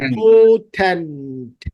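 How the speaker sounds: "Important" slowly, each syllable held; drawn-out pronunciation demo. Shown as a demonstration. Teacher shows where the tongue goes, "important" slowly sounded out. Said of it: "তাও জিহ্বা এক এক জায়গায় যাচ্ছে".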